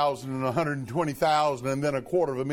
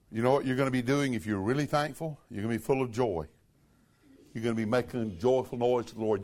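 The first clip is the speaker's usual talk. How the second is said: normal talking voice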